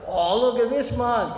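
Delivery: high, loud mock Bogan exclamation; Bogan Australian. Drinker reacting to the card reader, mock Bogan accent. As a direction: imitate an amazed Australian Bogan calling out.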